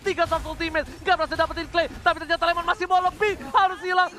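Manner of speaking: rapid hyped esports call; fighting game announcer. Acted. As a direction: ride the fight like a ringside caster. Live teamfight play-by-play.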